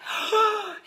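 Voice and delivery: sharp high shocked gasp. Retold or imitated. Passing mom gasps at fallen boy, high and shocked. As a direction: gasp in alarm at the fallen kid.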